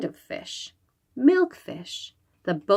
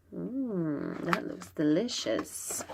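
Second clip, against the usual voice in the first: savoring murmur; 10 dB quieter than usual. Acted. Monster eyes the noodles.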